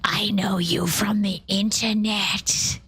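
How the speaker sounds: breathy, slow goblin hiss; creepy goblin whisper. Acted. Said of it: "M did tell us to act like a goblin".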